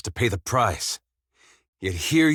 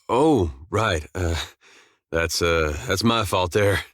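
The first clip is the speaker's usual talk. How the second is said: usual speaking voice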